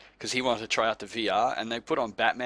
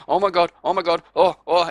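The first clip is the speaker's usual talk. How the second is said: higher, louder hammed-up panic; mock terrified gasping; 5 semitones higher, 7 dB louder than usual. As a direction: ham up a VR player's fright. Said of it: "They're going".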